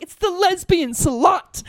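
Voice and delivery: slow, high shout; defiant call. Retold or imitated. Insulted woman announces herself at his door, defiant shout. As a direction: holler it through a door, stretching every word.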